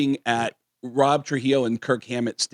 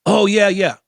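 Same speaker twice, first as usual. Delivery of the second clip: assistant's reply; 8 semitones higher, 5 dB louder than usual. Retold or imitated. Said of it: "He goes".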